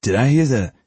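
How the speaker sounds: talking around a toothbrush; toothbrush in mouth. Acted. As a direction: mumble past a toothbrush. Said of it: "with a toothbrush in his mouth".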